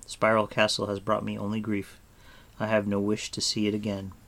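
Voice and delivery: usual speaking voice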